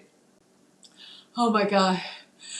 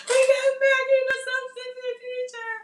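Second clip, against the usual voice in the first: excited announcing. Retold or imitated. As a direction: hype her arrival. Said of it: "let me see if I can do it".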